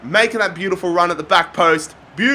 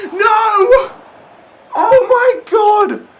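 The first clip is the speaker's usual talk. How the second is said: anguished cry; 13 semitones higher, 5 dB louder, much slower than usual. Real, not acted. Frustration at misses.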